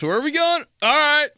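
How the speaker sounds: put-on high, carefree kid voice; kid along for the ride. Child in the back seat on a road trip, high and carefree. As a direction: chirp it like a happy little kid on a trip. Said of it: "just road trips".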